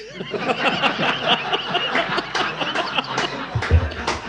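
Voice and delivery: long breathy high laugh. Real, not acted. Old manager cracking up at his own story, high laugh. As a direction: let a real laugh run out.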